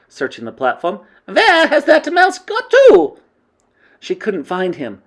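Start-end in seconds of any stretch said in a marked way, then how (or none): 1.2-3.2 very high, loud, stretched demand; snapping witch. A narrator's reading. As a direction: screech the question in irritation. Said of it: "snapped the Grand High Witch".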